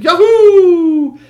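high, loud laughing whoop; thrilled whoop. Acted. Boy whooping as the chariot launches, laughing. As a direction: let out a joyful yell as the ride starts. Phaethon starts the ride.

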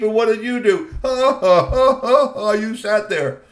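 mocking taunt. Troll jeering at the human, cackling with scorn. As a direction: laugh right in his face. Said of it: "He stood up and he said".

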